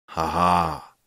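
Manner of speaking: understated villain's spoken laugh; a slow, mirthless ha-ha, read not roared; horrible laugh. A narrator's reading. Kopelius laughing at the pleading father, mildly sinister laugh. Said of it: "Kopelius wybuchnął okropnym śmiechem".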